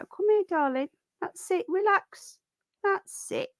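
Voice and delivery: calm the tiny bunny down. Real, not acted. Soothing tiny sick rabbit.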